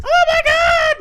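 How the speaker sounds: shrill, very high fangirl scream. Pretend. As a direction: scream like a thrilled fan. Girls screaming in delight.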